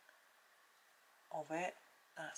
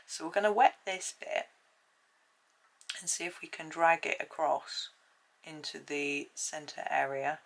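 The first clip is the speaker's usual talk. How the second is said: ordinary talk, nothing marked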